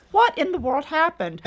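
high-pitched, incredulous demand retold; baffled alarm. Customer calling the phone company, baffled alarm. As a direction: act out bewildered dismay.

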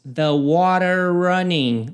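slow "The water running" with a flapped t and linked words; linking demo; slow linked phrase. Shown as a demonstration. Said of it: "presta atenção aqui na conexão".

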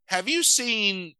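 high-pitched put-on character voice; Miss Mittens. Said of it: "Miss Mittens comes up and says".

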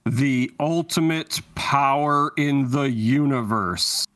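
slow, portentous intoning; ominously stately recruiter; grand Imperial interviewer. Acted. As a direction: draw it out with solemn grandeur.

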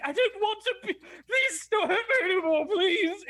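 hammed-up sobbing plea; pathetic pleading. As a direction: beg pitifully like a cowering wretch. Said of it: "pathetically go on the floor and be like".

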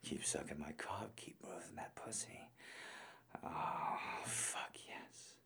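breathless explicit whisper. Acted. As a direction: whisper it close to the mic. Whispering throughout.

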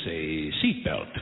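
low, soft deadpan callback. Acted. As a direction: drop it flat and quiet.

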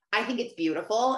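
ordinary talk

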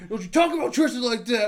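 mock indignant defense. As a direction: act out his huffy warning.

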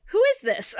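mock dismissive, high-pitched question. Friend acting out Buffy answering Cordelia's call, dismissive. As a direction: imitate a brush-off, pitched well up. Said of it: "like, so dismissive".

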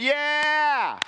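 high excited whoop of approval; crowd cheering. Retold or imitated. The hometown crowd cheering Jesus, high and excited. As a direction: cheer like a thrilled congregation. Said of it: "you can feel them going".

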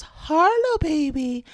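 put-on high, stretched sing-song greeting to a baby; baby-talk pitch. Kids greeting their baby sister, high baby-talk. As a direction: imitate a child cooing way up high. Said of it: "go up, um, an octave apparently".

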